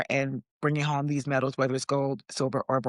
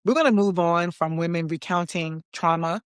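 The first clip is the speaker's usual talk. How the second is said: ordinary delivery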